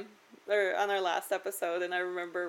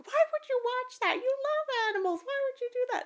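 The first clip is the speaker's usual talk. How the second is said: high incredulous sister voice; shocked sister. Retold or imitated. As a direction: play the baffled sister. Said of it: "She's like".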